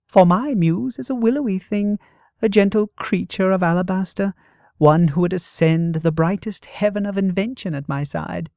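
high, loud, swooning reverie; dreamy rapture. Acted. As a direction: rhapsodize about an ideal muse. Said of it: "his expression misty-eyed".

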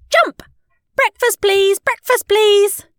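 very high, loud squeal; excited child's shout. A narrator's reading. Maisie jumps on her sleeping parents, excited shouting. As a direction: squeal it as you pounce.

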